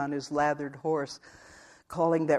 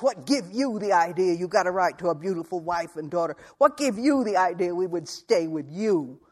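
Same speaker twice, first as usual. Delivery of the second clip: louder, rough, contemptuous challenge; scornful scolding; 3 semitones higher, 4 dB louder than usual. Acted. Wife scornfully challenging her husband in front of everyone. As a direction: put him in his place.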